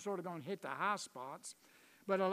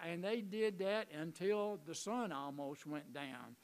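ordinary talk, nothing marked

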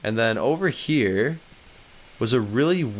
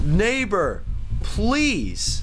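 playful pleading; 8 semitones higher, much slower than usual. Pretend. Begging the game's neighbor.

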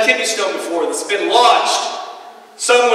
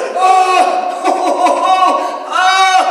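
high, loud moaning in pain, breaking into laughs; mock agony groans, pitched way up; pained groaning; 13 semitones higher, 4 dB louder, slower than usual. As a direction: groan like a kidney stone is stuck. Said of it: "I was like".

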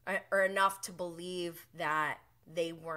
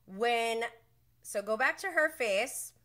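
usual speaking voice

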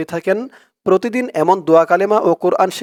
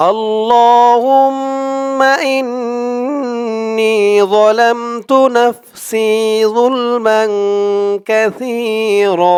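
slow, melodic, higher Arabic chant; slow recitation; 5 semitones higher, much slower than usual. Shown as a demonstration. Teacher leading viewers to recite along. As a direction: recite the line slowly for them to repeat. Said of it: "আমার সাথে বলুন".

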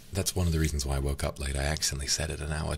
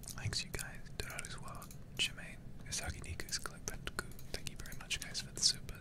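hushed whispered thank-yous, much softer; 13 dB quieter than usual. Real, not acted. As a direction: whisper each name like a secret. Quiet thanks for supers.

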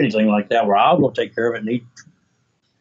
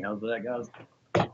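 quiet aside; 4 semitones lower, 13 dB quieter, much slower than usual. Real, not acted. Aside after disclaimer.

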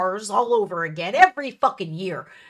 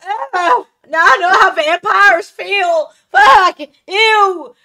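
pained sun-blinded groan; 9 semitones higher, 10 dB louder, slower than usual. Retold or imitated. Squinting into bright winter sun.

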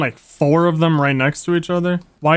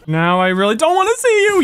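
mock singsong; 11 semitones higher, faster than usual. Continues the taunt.

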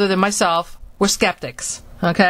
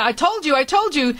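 higher-pitched mock triumph; vindicated brother; 7 semitones higher than usual. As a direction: imitate an excited I-told-you-so. Brother's vindicated quote, retold.

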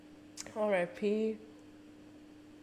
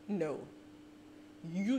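ordinary talk

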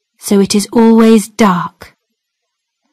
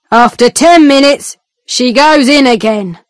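gruff guard; 2 semitones higher than usual. Acted. Guard tells the visitor his time, curt. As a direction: state the limit like a bored jailer.

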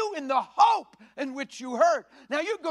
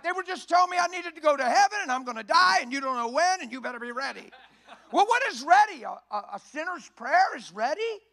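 ordinary delivery

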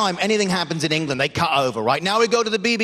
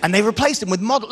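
usual speaking voice

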